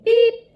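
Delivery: high, loud honk from a little truck; bright pop of a horn, voiced high; little truck beep. A narrator's reading. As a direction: toot a short, high beep. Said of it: "said Blue".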